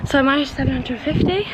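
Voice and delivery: panting after skipping; breathless, between breaths. Real, not acted. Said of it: "Pretty out of breath now".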